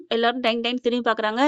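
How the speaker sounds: ordinary delivery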